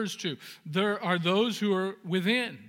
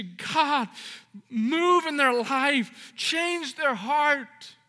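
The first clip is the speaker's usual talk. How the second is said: raised, fervent preacher's plea; preaching at full force; 6 semitones higher, 5 dB louder than usual. Acted. Pastor urges the congregation to keep praying, fervent preaching. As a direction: lift it to the back pew, pleading.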